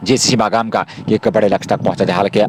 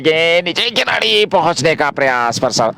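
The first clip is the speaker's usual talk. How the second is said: excited cricket commentary; 8 semitones higher than usual. Real, not acted. Ball in the air, fielder chasing.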